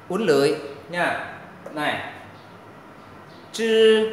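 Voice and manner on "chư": "Chư" held long on a high level tone, tongue curled up. Shown as a demonstration. Teacher models "Chư" for learners, tongue curled. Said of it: "lưỡi mình nó uốn cong lên".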